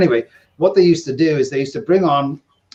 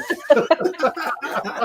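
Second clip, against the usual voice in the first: high burst of laughter; 13 semitones higher, 4 dB quieter than usual. Real, not acted. Teller laughing at his own casino story, laughing.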